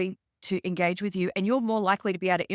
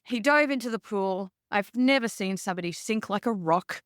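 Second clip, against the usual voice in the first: ordinary talk, nothing marked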